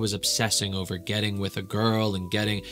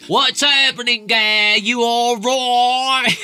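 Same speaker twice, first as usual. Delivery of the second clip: loud, high, hammed-up cockney holler; mock cockney; 12 semitones higher, 8 dB louder, slower than usual. Host mimicking the type of guy she likes, mock cockney. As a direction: stretch it out in an exaggerated cockney yell. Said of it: "That's cockney, right? That's how they talk".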